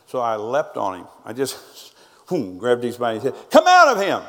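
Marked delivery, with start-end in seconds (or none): 3.5-4.2 a full-force shout commanding the demon out; retold deliverance command shouted high and loud. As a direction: bellow it like casting out a devil. Pastor commanding demon to leave.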